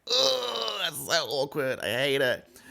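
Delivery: high groaning cringe; exaggerated awkward recoil; mock cringe. Cringing reaction to awkward scene.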